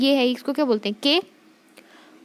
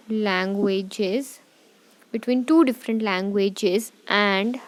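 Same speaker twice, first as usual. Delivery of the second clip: very slow, spaced words for notes; slow note dictation; much slower than usual. Shown as a demonstration. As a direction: dictate slowly with pauses.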